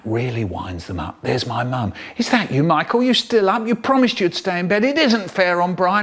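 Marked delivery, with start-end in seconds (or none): 2.2-6.0 higher, exasperated motherly voice; high-pitched parental reproach; scolding mum. Acted. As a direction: tell off a child through the door. Mum scolding child through the door.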